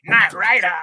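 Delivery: punchy prompt to copy; Knight Rider catchphrase. Shown as a demonstration. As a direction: announce it like a TV catchphrase. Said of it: "put that back on and say".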